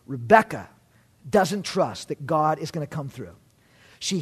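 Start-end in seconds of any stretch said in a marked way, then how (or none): none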